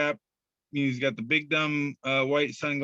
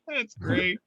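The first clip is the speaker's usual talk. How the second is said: laughing. Real, not acted. Laughs at the meme.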